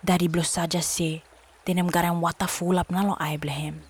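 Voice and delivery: plain reading voice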